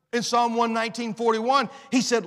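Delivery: ordinary talk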